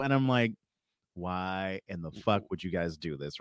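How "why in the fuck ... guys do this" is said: low, slow, soft aside; muttered disbelief. Retold or imitated. Comic hearing the client's instructions, quietly exasperated. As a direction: grumble it slowly to yourself.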